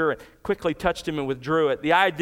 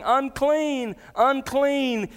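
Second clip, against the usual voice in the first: retold yell; 8 semitones higher, much slower than usual. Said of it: "Instead of yelling".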